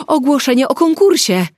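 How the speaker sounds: sudden loud high exclamation; shout. A narrator's reading. Nina shouting out the sudden realisation, loud and high. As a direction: blurt it out as a realisation. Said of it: "krzyknęła Nina".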